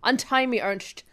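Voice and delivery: stern captive spy. Acted. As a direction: demand release with mock gravity.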